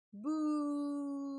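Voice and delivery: high, loud mock boo; playful boo. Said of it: "Mama say".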